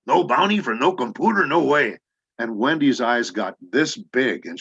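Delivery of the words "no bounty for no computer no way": high, loud Mexican-Texan cowboy voice; Punch. Retold or imitated. Actor answers director in character, in loud Mexican-Texan accent. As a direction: belt the catchphrase in mixed Mexican-Texan accent. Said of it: "And I said".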